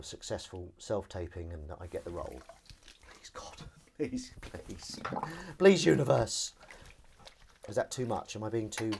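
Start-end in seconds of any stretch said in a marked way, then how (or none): 3.1-5.0 whispered plea. Pretend. Pleading for universe's help. 5.6-6.5 mock plea. Pleading aloud to the universe.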